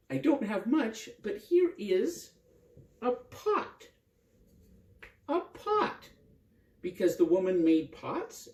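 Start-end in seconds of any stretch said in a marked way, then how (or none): 0.1-3.9 higher, slower offering; lightly voiced village woman; woman's voice. Woman offers her pot. 5.3-6.1 very high, wondering echo; playful high echo; amused surprise. A narrator's reading. Narrator echoes with wonder.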